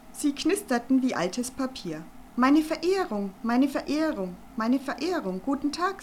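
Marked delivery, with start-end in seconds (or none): 2.3-6.0 loud, high, hurried nervous greeting; bow and babble nervously. Acted. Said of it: "sagte er nervös". (nervös, laut und hoch hervorgesprudelt)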